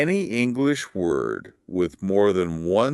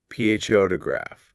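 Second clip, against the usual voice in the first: "P H O to graph" with the opening "P H O" stressed; stress on first syllable; 3 semitones higher than usual. Shown as a demonstration. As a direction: punch the start of "P H O to graph". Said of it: "Now listen where the stress is".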